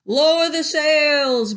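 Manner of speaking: slow, loud, high storm order; captain shouting. A narrator's reading. Captain shouts orders to crew in storm. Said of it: "Braidbeard shouted".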